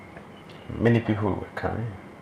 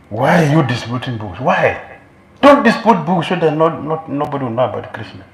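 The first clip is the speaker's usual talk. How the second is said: envious scolding; 4 semitones higher, 8 dB louder than usual. Retold or imitated. Voicing envious devotee scolding.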